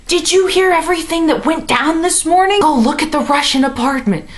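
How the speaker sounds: breathless news from the roommate; excited roommate. Retold or imitated. Roommate rushing over with gossip, mock excited.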